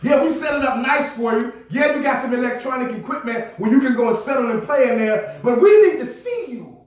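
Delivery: high-pitched, urgent sermon rhythm; impassioned preaching. Real, not acted. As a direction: preach with rising fervour, pressing the congregation. Preaches at full force.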